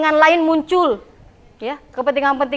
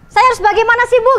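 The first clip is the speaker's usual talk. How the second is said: higher-pitched student asking; student's plea; 7 semitones higher, 3 dB louder, faster than usual. Acted. Student asking the teacher how to get skilled, pleading. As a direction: ask as a puzzled student, pitched up.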